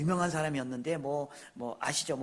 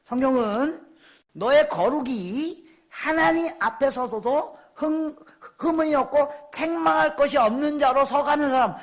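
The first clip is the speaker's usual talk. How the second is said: emphatic, raised preaching voice; forceful preaching; 9 semitones higher, 8 dB louder, slower than usual. Real, not acted. Preacher tells congregation what the Bible demands, forceful preaching. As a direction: press the point on the congregation.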